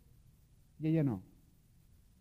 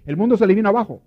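"el mundo se le vino abajo" repeated louder and higher, "mundo" hit hard; emphatic repeat; 5 semitones higher, 10 dB louder, faster than usual. Shown as a demonstration. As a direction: drive the repeated phrase home with force. ("mundo" recalcado, más fuerte y agudo)

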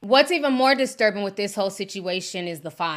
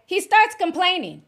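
ordinary talk

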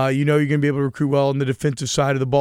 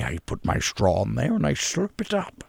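put-on old-timey American accent; 3 dB quieter than usual. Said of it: "old-timey American accent".

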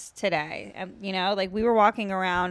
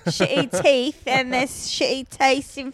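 mock British accent; 3 semitones higher, 4 dB louder than usual. Ranting about British people in a light mock British accent. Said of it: "your British accent's making me".

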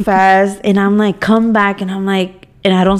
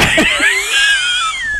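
squealing laugh; high shrieking laugh. Real, not acted. Friend laughing at her daughter's habit, high squeal.

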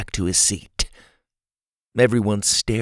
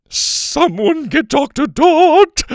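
very high, loud, stretched cry; panicked order; 7 dB louder, slower than usual. A narrator's reading. Sloppy Joe orders the class to fetch help, panicked. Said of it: "Sloppy Joe ordered".

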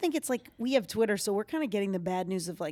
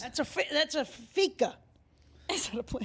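high-pitched goofy bit voice; playful, pitched-up character; put-on character voice; 6 semitones higher than usual. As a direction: play along in a high put-on voice. Plays along in character voice.

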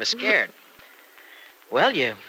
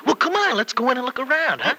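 eager urging; 12 semitones higher than usual. Acted. Eager husband urging wife inside.

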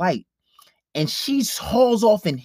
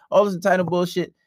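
ordinary talk

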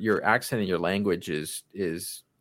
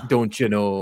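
louder mock Canadian catchphrase; typical Canadian accent; 6 dB louder than usual. Speaker likening Minnesota to Canada, mock Canadian accent. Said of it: "pretty much the typical Canadian accent".

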